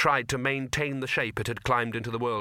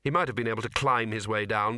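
ordinary delivery

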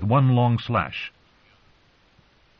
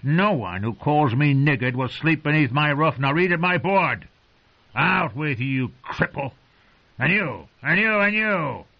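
furious wildling; 5 semitones higher than usual. Acted. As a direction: throw them out of his hall in rage. Craster furious at guests.

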